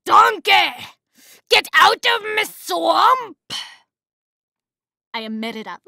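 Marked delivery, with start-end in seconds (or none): 0.0-3.4 put-on loud, high Scottish-accented bellow; full-force mock Scottish shout, dragged out; Scottish ogre accent. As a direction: roar it in a hammed-up Scottish accent. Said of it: "I say donkey to get into it".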